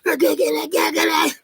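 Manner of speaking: very high, loud, strained craving cry; high wailing mock predator demands; frantic predator voice. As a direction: shriek like a desperate addict grabbing. Acted predator frustrated.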